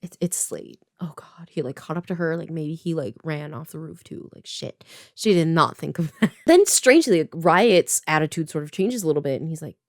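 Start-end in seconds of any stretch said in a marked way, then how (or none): 0.0-4.8 soft hushed dread; a quiet spooked aside; dread whisper. Retold or imitated. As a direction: whisper it like he might hear. Villain appears, dread.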